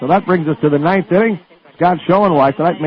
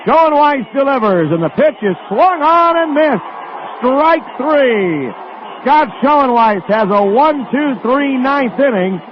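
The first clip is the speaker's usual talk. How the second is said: excited final out call; 7 semitones higher than usual. Real, not acted. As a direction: pitch jumps high as the strikeout lands. Final strikeout called live.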